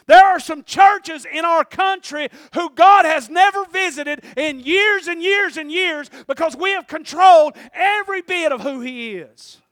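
loud, slow, high preaching cry; full-force preaching. Acted. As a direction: thunder it from the pulpit.

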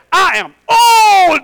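drawn-out, high wailing shout; Zechariah. Acted. Preacher mimicking Zechariah's rebuked answer, wailing shout. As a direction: bellow it slowly as a loud complaint. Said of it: "she didn't say it like that".

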